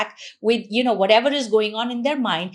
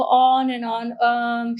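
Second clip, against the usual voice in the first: slow, confused rambling; slower than usual. Shown as a demonstration. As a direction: drone it out on a level pitch. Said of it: "He's so confused and slow".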